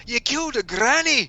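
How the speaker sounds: high, shocked cry; shocked outburst. Retold or imitated. Dave Boy shouting after granny is shot, shocked. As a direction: blurt it out in high disbelief. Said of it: "He's like".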